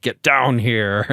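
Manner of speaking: slow menacing ninja taunt; scorpion voice. Retold or imitated. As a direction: drag it out like Scorpion. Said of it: "He recorded some new lines".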